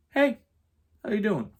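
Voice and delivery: awkward greeting. Retold or imitated. Roommate greeting the guy back at the door, awkward high voice.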